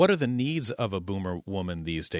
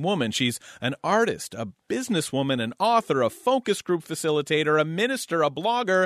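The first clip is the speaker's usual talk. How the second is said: louder and higher with each title, rising to a breathless peak; building excitement; 5 semitones higher, 4 dB louder than usual. Real, not acted. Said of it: "I gotta catch my breath".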